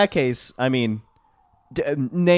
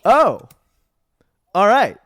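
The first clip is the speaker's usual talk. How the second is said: high, loud "oh, all right"; a let-down "oh, all right"; mildly surprised; 6 semitones higher, 7 dB louder than usual. Retold or imitated. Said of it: "I saw that and was like".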